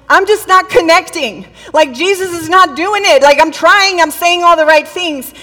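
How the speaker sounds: loud, high-pitched frustrated venting; exasperated complaint. Retold or imitated. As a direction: act out being fed up and at a loss. Retelling Martha's frustrated complaint.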